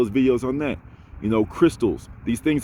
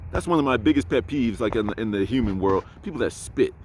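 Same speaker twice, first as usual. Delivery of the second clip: ordinary delivery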